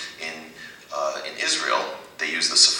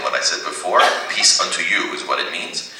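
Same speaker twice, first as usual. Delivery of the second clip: ordinary talk, nothing marked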